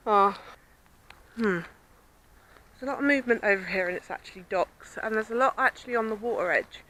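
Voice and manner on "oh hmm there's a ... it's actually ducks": ordinary talk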